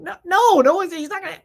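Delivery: flustered refusal. Retold or imitated. Friends panic at her suggestion.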